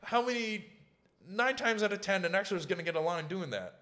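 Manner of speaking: high, loud retold rant; mock exasperated complaint. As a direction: push the pitch way up, griping for comic effect.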